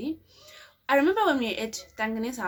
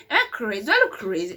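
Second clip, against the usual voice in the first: louder, indignant second asking; louder repeat; 8 dB louder, faster than usual. Retold or imitated. To viewers about giving the real number, louder. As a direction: push it out as if insulted.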